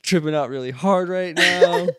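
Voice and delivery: high, loud, laughing and crying; tripping on acid. Retold or imitated. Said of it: "you were just like".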